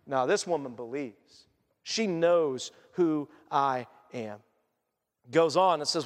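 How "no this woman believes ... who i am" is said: low, slow and softer, with weighty pauses; slow, firm answer. Acted. Jesus answering the protesting disciples, slow and quietly firm. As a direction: let each word land calmly.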